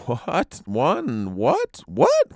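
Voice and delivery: clamor eagerly for an answer. A narrator's reading. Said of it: "clamored eager voices".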